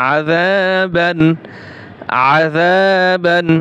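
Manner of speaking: very slow, each syllable stretched and clear; slow drawn recitation. Shown as a demonstration. Teacher showing students how to recite, slow and drawn. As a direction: draw out আযাবান slowly, every letter distinct. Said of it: "স্পষ্ট হবে".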